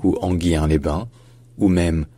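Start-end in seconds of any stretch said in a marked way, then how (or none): 0.2-1.1 Anguien-les-Bains said "an-ghyin-lay-ban"; the mistaken glided reading of Anguien-les-Bains; mispronounced with glide. Shown as a demonstration. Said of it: "ça ne se dit pas".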